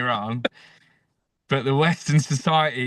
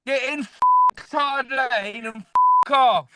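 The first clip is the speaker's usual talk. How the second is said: hammed-up road rage, much higher; mock rage rant; 12 semitones higher than usual. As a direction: scream abuse at the bad driver. Ranting at lane blockers.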